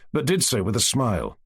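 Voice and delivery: ordinary delivery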